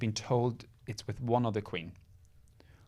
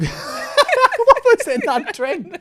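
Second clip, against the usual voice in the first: high, loud, slow laughing tease; 16 dB louder, much slower than usual. Real, not acted. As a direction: laugh through the question. Teasing laughter.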